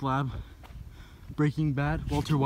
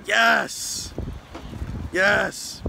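excited cheer; 3 semitones higher than usual. Real, not acted.

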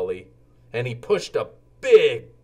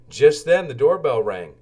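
plain reading voice

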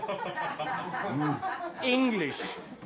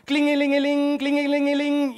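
high loud ringing; mock ringing telephone; phone ring imitation; 8 semitones higher, 6 dB louder than usual.

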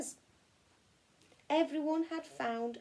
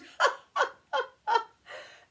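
high, louder hearty laugh; 8 dB louder than usual. Acted. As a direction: laugh out loud at the silly fright. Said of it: "Then he laughed out loud".

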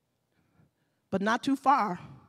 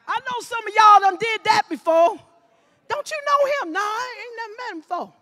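loud, high-pitched accusing voice; heated accusation; 10 semitones higher, 11 dB louder than usual. Retold or imitated. Preacher voicing the crowd accusing Peter, loud and high. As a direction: hurl the accusation at him. Acted quarrel, accuser and denial.

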